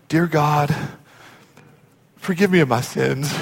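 low, soft bowed-head prayer; hushed prayer. Retold or imitated. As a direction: pray quietly, haltingly, as if head bowed. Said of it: "he bows his head and he goes".

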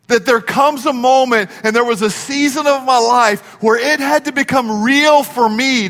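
fervent pulpit appeal; preaching. Acted. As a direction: drive it home from the pulpit. Preaching climax.

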